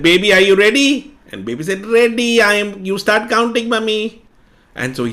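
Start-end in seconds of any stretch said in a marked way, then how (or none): 0.0-1.2 loud call from outside the room, higher; mother calling to her hiding child, loud. Acted. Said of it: "And she said". 1.9-4.2 put-on high, eager little boy; child answering mommy, high and eager; child's voice. Child answers mother in game.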